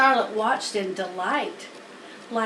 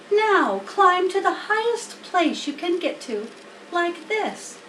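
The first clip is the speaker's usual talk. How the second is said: higher, encouraging spider voice; Charlotte coaching; 7 semitones higher than usual. Acted. Charlotte instructing Wilbur, lightly voiced spider. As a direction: urge the pig upward.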